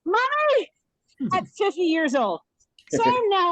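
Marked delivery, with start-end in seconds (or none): 0.0-0.7 high, mock yell for a lost parent; re-enacted searching cry, pitched way up; retold yelling. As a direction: call out high, without real volume. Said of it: "ran down the streets yelling".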